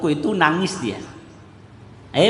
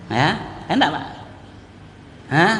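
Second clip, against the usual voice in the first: usual speaking voice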